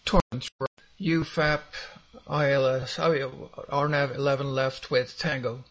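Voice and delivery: cutting out radio. Real, not acted. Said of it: "Aircraft calling is cutting out".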